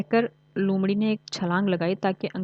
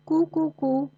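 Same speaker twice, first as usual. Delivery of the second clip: high sung koo-koo of a cuckoo bird; cuckoo call; 8 semitones higher, much slower than usual. A narrator's reading. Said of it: "कोयल फिर cookie". (कोयल की ऊँची कूक)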